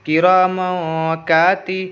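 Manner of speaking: "किरामों काती" slowly, "मों" held in the nose; long nasal hum on "मों" before "काती"; ikhfa nasal hold. Shown as a demonstration. Teacher builds the word, ikhfa nasal hold. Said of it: "नाक में आवाज़ को रोकेंगे".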